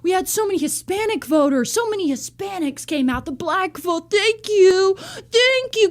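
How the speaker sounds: lightly put-on Trump gushing over voter groups, building to an overdone thanks; mocking Trump. Vlogger mocks Trump thanking voters, hammed-up gushing. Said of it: "Remember when he was like".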